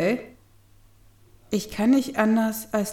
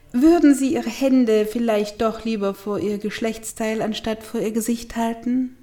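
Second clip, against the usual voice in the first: ordinary delivery, nothing marked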